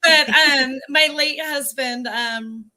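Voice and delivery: fighting tears as you name him; a real sob breaking through the words; real crying. Real, not acted. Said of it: "I will still get emotional".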